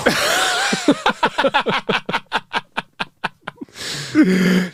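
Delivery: long high burst of laughter. Real, not acted. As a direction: laugh hard at the punchline. Laugh after punchline.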